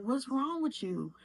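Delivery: high, startled question; alarmed auntie. Retold or imitated. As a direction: ask it suddenly worried. Auntie hears her on phone.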